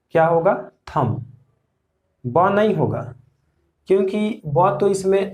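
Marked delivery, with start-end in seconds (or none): 0.9-1.3 थंब said as thum, B left silent; correct silent B. Shown as a demonstration. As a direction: end थंब on the M, no B. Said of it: "correct pronunciation इसका थंब होगा". (थंब का सही उच्चारण, बी चुप)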